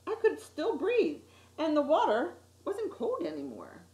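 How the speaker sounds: ordinary delivery, nothing marked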